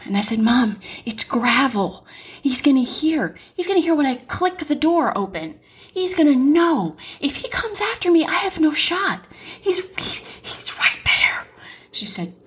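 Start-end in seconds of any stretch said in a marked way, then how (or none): 0.4-11.5 scared, hushed and pleading; terrified half-whisper; frightened whisper. Retold or imitated. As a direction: hiss it out, afraid he'll hear. Hiding from killer, on phone.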